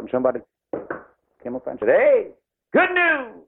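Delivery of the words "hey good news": excited shout. Retold or imitated. Messenger bursts in with news.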